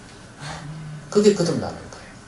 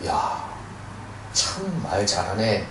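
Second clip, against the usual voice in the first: impressed listener; 4 semitones lower, 7 dB quieter, much slower than usual. Retold or imitated. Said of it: "이렇게 생각하는 사람도 있겠죠?".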